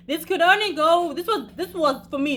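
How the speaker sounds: high, loud words broken by laughter; laughing while talking. Real, not acted.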